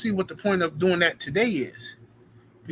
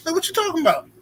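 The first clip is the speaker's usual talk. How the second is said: pitched way up in disbelief; baffled high question; 10 semitones higher, faster than usual. Real, not acted. Streamer confused by a chatter's apology, high disbelief. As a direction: ask it as if truly lost.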